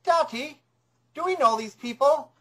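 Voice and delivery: hint of a small child asking a parent; little boy. Investigator relaying a recorded little boy, lightly childlike voice. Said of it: "this accent of a little boy".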